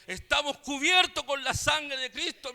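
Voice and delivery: preacher booming the repeat to the congregation; preaching proclamation. Real, not acted. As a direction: proclaim it with full force. Preacher repeats key phrase louder.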